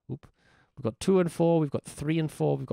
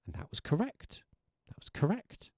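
softer, hushed that was correct; whispered confirmation; 5 semitones higher, 6 dB quieter than usual. Shown as a demonstration. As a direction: hush it like a secret. Softly confirming.

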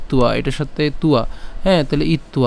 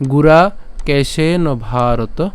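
slow syllabic reading; much slower than usual. Shown as a demonstration. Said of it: "খুব সহজে আমরা উচ্চারণ করতে পারি".

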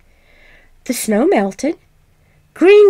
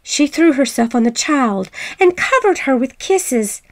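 ordinary delivery, nothing marked

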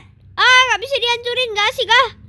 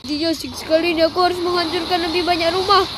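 low, lumbering giant elephant gleefully wrecking the town; lower-pitched playful elephant monster voice; 4 semitones lower, 3 dB quieter than usual. Acted. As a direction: rumble it out like a huge clumsy elephant on a rampage. Elephant again, enjoying destruction.

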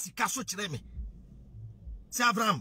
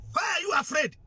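raised, higher challenge to politicians; angry shout; 6 semitones higher than usual. Real, not acted. Challenging politicians, rising anger.

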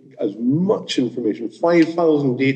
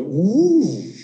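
rising ooh; 6 semitones higher than usual. Retold or imitated. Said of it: "the sales just went".

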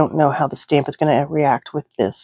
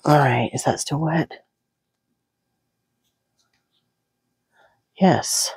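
whispering; 3 dB quieter than usual. Real, not acted. Whispered check of drying ink.

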